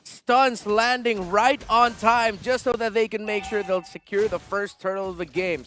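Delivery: loud, high, stretched-out announcer call; hype play-by-play. Real, not acted. Caster hyping a drive-by from Demon Kite, excited call. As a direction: draw out each phrase with punch.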